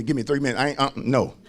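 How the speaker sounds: ordinary talk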